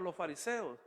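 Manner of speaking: normal talking voice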